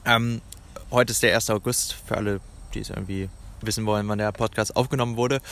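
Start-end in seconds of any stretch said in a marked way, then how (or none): 0.0-0.4 talking with a mouth full. Real, not acted. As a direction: mouth full of bread and cheese. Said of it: "mit vollem Mund rede".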